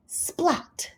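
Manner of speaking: splat sound. A narrator's reading. Whipped cream splat sound.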